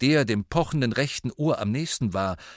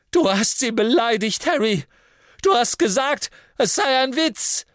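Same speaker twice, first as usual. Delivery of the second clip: high, loud furious shout; 14 semitones higher, 6 dB louder than usual. A narrator's reading. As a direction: yell the accusation from upside down. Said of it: "rief Ron".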